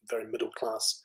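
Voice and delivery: whispered under the breath; whispered aside. Real, not acted. Whispering an aside to the audience. Aside about own accent.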